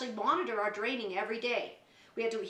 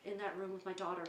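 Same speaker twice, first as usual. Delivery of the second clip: quiet; 5 semitones lower, 8 dB quieter than usual. Retold or imitated. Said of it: "I went".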